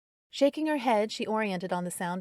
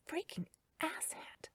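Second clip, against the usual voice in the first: slow, much softer whisper under the breath; whispered curse; 10 semitones higher, 11 dB quieter, slower than usual. A narrator's reading. Carrie cursing Darren privately, slow whisper. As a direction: hiss it to yourself, annoyed.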